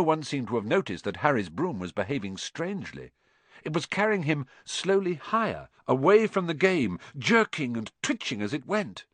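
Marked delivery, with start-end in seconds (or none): none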